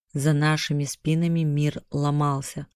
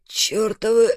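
pained curse; 6 semitones higher, 4 dB louder than usual. A narrator's reading. Choked by dark magic, cursing.

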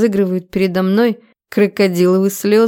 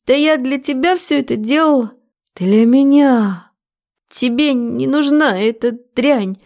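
higher female voice, impassioned; desperate woman; 6 semitones higher than usual. A narrator's reading. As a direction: plead that it was all for him. Woman protests she did it for him.

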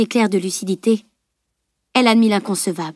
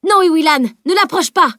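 sharp high shouted warning; cry of warning; 8 semitones higher, 4 dB louder than usual. A narrator's reading. Edwin shouting a warning to Iwilan. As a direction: shout to stop her cold. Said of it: "Un cri d'Edwin".

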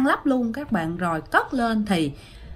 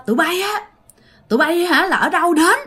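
imitated scolding, higher-pitched throughout; acted-out high, indignant telling-off, louder at the end; scolding old woman; 6 semitones higher, 3 dB louder than usual. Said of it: "một cái bà già bà chửi".

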